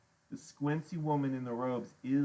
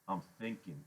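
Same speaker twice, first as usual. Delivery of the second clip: ordinary delivery, nothing marked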